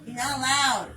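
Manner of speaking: loud, playful scolding; a hammed-up, loud "no!"; mock scolding. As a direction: stop them like a strict grandma. Playful scolding after cursing talk.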